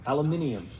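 "aluminium" as "al-yoo-MIN-ee-um", British way; British-style "aluminium", ending in "-ee-um"; British pronunciation. Shown as a demonstration. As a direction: attempt a British "aluminium" with the extra syllable. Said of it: "now I can't say it the British way".

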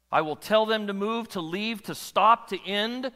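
high, loud preaching build; full-force preaching. Acted. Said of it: "preaching at full force".

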